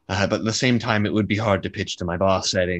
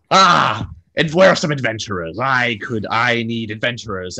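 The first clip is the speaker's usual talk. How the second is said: booming portly man; 3 semitones higher, 6 dB louder than usual. Acted. Portly man bursting into the tavern, calling loudly. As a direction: announce yourself to the whole tavern. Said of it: "And he says".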